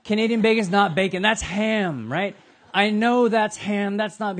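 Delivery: mock-indignant rant about Canadian bacon. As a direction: scoff at Canadian food, worked up. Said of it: "And they're like".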